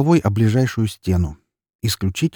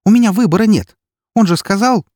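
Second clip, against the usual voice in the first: very high, loud outburst; exasperated protest; 14 semitones higher, 8 dB louder than usual. A narrator's reading. Эри insists she had no choice, loud and exasperated. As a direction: protest hotly, voice pushed right up.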